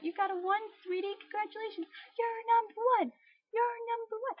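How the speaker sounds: very high, sugary mock praise; hammed-up proud relative cooing; gushing congratulations. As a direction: coo it high and loud, stretching the last words. Mimics family gushing over a one.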